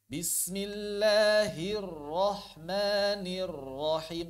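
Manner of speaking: slow, sustained Quranic recitation; measured chant with long vowels; held tajwid recitation. Shown as a demonstration. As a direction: dwell on each doubled r before releasing. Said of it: "Tahan dulu".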